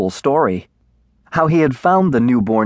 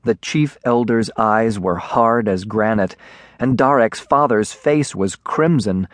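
usual speaking voice

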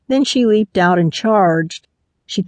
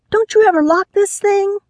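very high-pitched, indignant screech; outraged screech; 14 semitones higher than usual. A narrator's reading. Woman freed from the trunk, screeching. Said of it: "she screeched".

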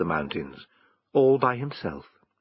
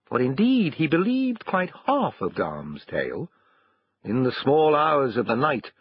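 ordinary delivery

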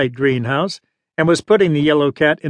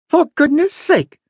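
put-on high-pitched old woman fussing; exasperated old lady; 11 semitones higher than usual. A narrator's reading. Exasperated old lady scolds boy.

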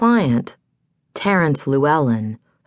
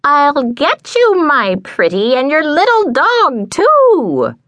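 very high, slow, loud cackling witch; Wicked Witch of the West; 13 semitones higher, 5 dB louder than usual. A narrator's reading. Witch threatening a girl and her little dog, cackling. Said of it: "cackled the Wicked Witch of the West".

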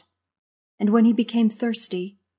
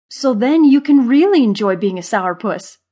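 loud, higher, gleeful punchline; playful teasing; 4 semitones higher, 9 dB louder than usual. A narrator's reading. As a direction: land the tease with a grin. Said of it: "Grinning, he added".

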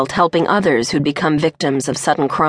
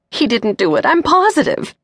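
babbling; 9 semitones higher than usual. Acted. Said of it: "Dana was babbling".

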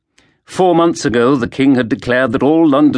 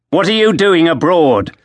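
put-on raised, stern young guard; young watchman; 7 semitones higher, 4 dB louder than usual. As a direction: call out like a night watchman. Watchman challenges Crispin.

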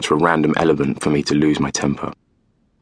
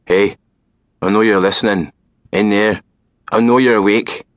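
calling out; 5 semitones higher than usual. A narrator's reading. Scottish neighbour calling to the narrator, insistent, building. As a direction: push him to admit he's awake.

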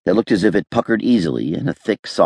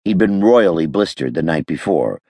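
ordinary delivery, nothing marked

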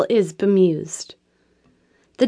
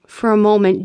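usual speaking voice

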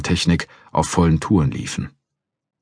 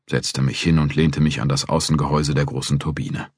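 ordinary delivery

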